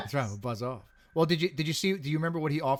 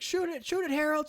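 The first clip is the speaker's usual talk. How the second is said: panicked yell; 14 semitones higher than usual. Retold or imitated. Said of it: "he's like".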